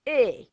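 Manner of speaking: E said loud and high as an open ay; Italian E sound. Shown as a demonstration. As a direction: belt the E like an Italian vowel. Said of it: "my Italian sound".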